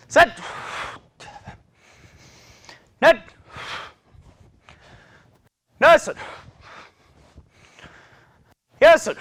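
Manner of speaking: 한 번씩 힘차게 외치는 구령; counting calls. Real, not acted. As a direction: bark out every number to drive the set. Instructor counting out kicks.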